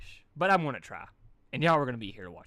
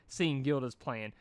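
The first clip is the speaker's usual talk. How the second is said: usual speaking voice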